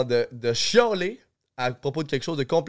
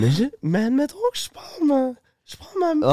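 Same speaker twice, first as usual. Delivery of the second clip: much higher, straining for the top register; 12 semitones higher than usual. Shown as a demonstration. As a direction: reach up for the high voice that isn't there. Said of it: "j'ai pas mon aigu". (voix aiguë forcée)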